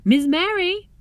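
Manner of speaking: high, loud call across the room; yelling out. A narrator's reading. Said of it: "Miss Lather yelled out".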